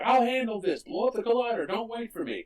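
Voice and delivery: high-pitched heroic instructions; imitated urgent orders. Acts out hero's urgent orders.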